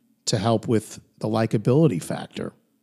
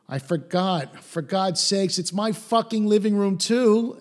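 mock indignant, rising higher; defensive outburst; 9 semitones higher than usual. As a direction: fire it back defensively, climbing in pitch. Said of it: "Larry gets a little defensive".

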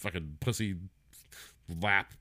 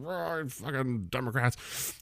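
slow, higher, garbled grumpy roar; mock grandpa yell; 4 semitones higher, 5 dB quieter, much slower than usual. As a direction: imitate an old man cursing at the TV. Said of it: "like, yell at the".

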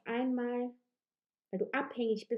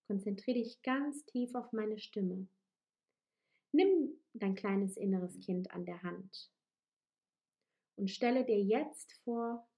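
guided meditation. Acted. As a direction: lead them gently into the images. Leads a guided visualisation.

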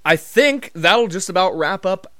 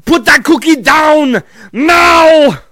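high, full-force movie-quote shout; frantic, stretched-out yelled impression; Arnold yelling; 12 semitones higher, 14 dB louder, much slower than usual. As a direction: bellow it like an action star, slowly drawn out. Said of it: "have Arnold just yelling".

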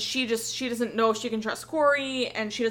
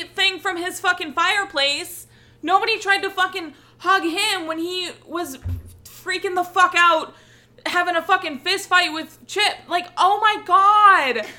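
heated rant; 6 semitones higher, 4 dB louder than usual. Real, not acted. Heated rant about double standard.

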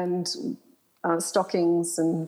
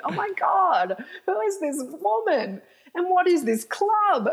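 much higher, slowed, wide-eyed wonder; amazed disbelief; 11 semitones higher than usual. Retold or imitated. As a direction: let each question hang in astonishment. Amazed by the club show.

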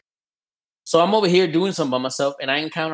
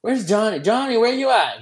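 calling out for Johnny in a higher voice; 8 semitones higher than usual. Retold or imitated. Squad searching for Johnny during the match, calling out. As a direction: shout across the map for a missing teammate. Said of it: "And we're like".

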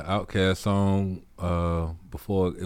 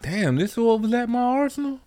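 much higher, mock-stunned exclaim; amazed realization; 4 dB louder than usual. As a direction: act out suddenly discovering your own power.

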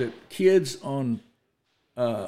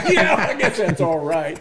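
amused mock acceptance; laughing, ironic; 3 semitones higher, 6 dB louder than usual. Real, not acted. Answering the host's defense of Jerry, laughing. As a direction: laugh and grudgingly allow it.

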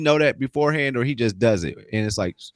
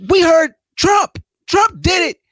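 much higher and louder burst; excited; 8 dB louder, slower than usual. Real, not acted. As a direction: blurt it out, all worked up. Excited jump into Trump topic.

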